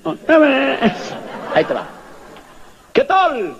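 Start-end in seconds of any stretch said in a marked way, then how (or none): 0.0-1.1 high, drawn-out echo; stretched ringing repeat. Retold or imitated. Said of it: "a ver qué te dice". 2.9-3.5 high, hollered greeting; mock distant shout; retold call-out. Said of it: "y gritábamos".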